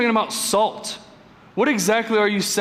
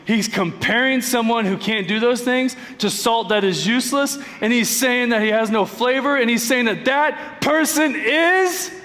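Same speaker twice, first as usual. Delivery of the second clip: ordinary talk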